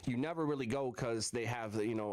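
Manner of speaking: usual speaking voice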